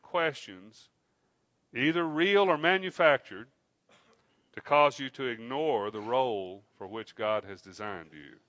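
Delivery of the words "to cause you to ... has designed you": usual speaking voice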